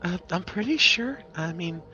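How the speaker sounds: high, hesitant shopkeeper voice; unsure potion seller. Acted. Seller asked if she is sure of the price, high and hesitant. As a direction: hedge in a high character voice.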